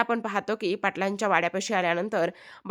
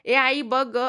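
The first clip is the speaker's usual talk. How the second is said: put-on boy calling out; 4 semitones higher, 4 dB louder, slower than usual. A narrator's reading. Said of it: "ओरडत असतो".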